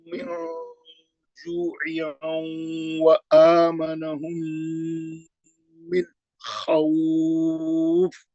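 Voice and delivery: very slow, drawn-out tajweed recitation; Quran recitation. Shown as a demonstration. Teacher recites the verse for students, slow and melodic. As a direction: recite it slowly, stretching each sound. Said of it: "मैं अभी पढ़ दे रहा हूँ".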